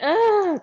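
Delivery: sharp high loud "ah" of alarm; a quick panicked yelp; startled gasp. Retold or imitated. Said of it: "And I just quickly went".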